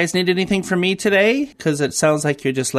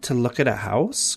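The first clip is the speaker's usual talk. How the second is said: normal talking voice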